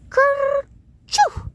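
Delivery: loud, high squeaky sneeze. Acted. Fur child sneezing again at grandpa, loud and high. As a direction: let a big high sneeze burst out.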